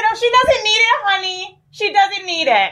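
high syrupy fake-sweet put-down; mock sweet dismissal. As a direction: kill her with fake kindness. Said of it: "I said".

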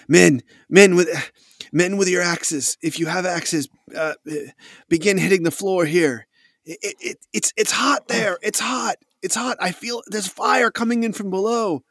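high, urgent, stammering alarm; rally the men in rising panic; panicked soldier. Acted. Trapped soldier directs men, reports fire.

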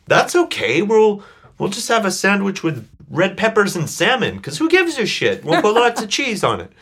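high, bright-eyed, bouncy mock cheer; imitating bubbly Cindy. Brother imitating his upbeat mentor Cindy, high bubbly mock cheer. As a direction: chirp it like an endlessly upbeat friend. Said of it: "she'd say".

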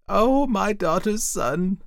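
high, loud, slow wail; anguished cry. A narrator's reading. Auntie cries out to her nephew, anguished. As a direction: cry out in despair to a loved one. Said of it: "she cried".

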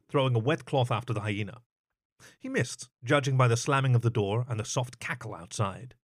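usual speaking voice

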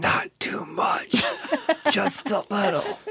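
slow, high whispered ghostly voice; soft echoing dream voice. Pretend. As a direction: whisper it slowly like a fading ghost.

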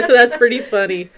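ordinary delivery

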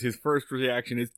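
normal talking voice

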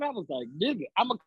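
usual speaking voice

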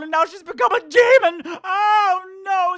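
high, loud hammy panic; mock horror. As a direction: shriek it like a campy scream queen. Mock horror at demon cat.

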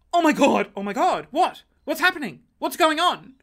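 high, loud flustered questions; panicked. Retold or imitated. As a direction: babble in alarm. Panic in the wrong car.

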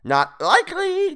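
very high, drawn-out creature jeer; gleeful cry. A narrator's reading. Creature scoffing at his captive's plan, crying out. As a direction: crow it slowly and loudly. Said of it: "Toadface cried".

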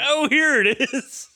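high, breezy mock nurse voice; airy put-on nurse chirp. Friend mimics the careless nurse handing it over, high and airy.

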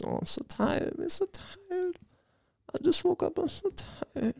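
put-on very high, soft sleepy little boy. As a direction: whine it like a drowsy little boy. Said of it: "Little sleepy boy voice".

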